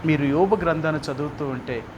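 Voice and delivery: ordinary talk, nothing marked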